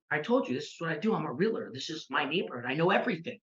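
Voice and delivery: soft, quick, offhand know-it-all; bluffing confidence. Retold or imitated. New realtor faking it to impressed buyers, quietly confident. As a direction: play it cool, quietly sure of yourself. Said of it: "It's called fake it till you make it".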